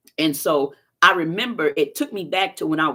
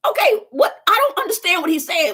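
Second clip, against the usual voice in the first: high, loud baffled outburst; squeaky disbelief, pitched way up; 13 semitones higher, 5 dB louder than usual. Retold or imitated. Baffled at doctor leaving.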